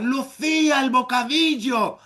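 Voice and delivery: high calling yell; shouted reminder from the doorway; mother's shout. Retold or imitated. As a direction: call her back for her sandwich. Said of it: "volvió a gritar".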